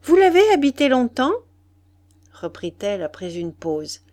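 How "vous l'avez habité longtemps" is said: high, loud, quick question; lively, lifted inquiry; eager questioning. A narrator's reading. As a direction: ask with keen interest. Julie eagerly questions companion.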